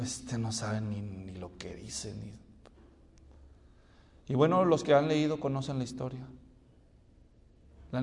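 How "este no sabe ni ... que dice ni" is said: low, hushed scoffing aside; muttered mockery. Crowd scoffing at Jesus under their breath, muttered mockery. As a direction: mutter it very softly and low, trailing away. Imitating those who mock Jesus.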